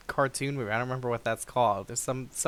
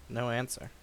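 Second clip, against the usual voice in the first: normal talking voice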